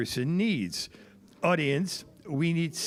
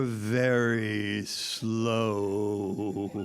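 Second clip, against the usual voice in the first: very slow demo; 7 semitones lower, much slower than usual. Shown as a demonstration. Host shows the audience very slow speech, dragged out. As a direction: stretch every word out very slowly. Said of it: "that was so slow".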